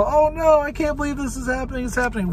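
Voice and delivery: tiny, very high squeal; fast squeaky mock despair, hammed-up; mock panic. Little onlookers seeing Earth destroyed, squeaky mock panic.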